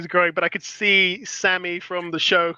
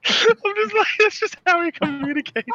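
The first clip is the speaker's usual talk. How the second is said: laughing; 9 semitones higher than usual. Real, not acted. Laughing while telling the bit.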